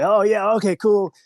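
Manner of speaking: a hint of a boss briskly dismissing an employee; Vince McMahon. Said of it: "You know, say".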